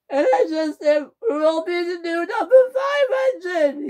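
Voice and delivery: high wailing sob through the words; crying. Acted. James crying to Edward about leaving the railway. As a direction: blubber it out, high. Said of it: "James began to cry".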